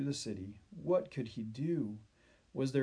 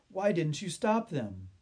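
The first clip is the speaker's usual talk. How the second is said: higher, louder reproach; upset accusation; 5 semitones higher, 5 dB louder than usual. A narrator's reading. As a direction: accuse him, voice raised. Boy accuses the friend.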